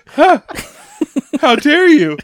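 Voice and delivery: high, loud scandalized gasp of protest; loud pretend indignation; mock outrage. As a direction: play the offended victim, hammed up. Dad acting scandalized at his daughters.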